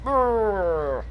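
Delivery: a frustrated engine-like brrr; frustrated growl. Retold or imitated. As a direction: grumble out loud in annoyance.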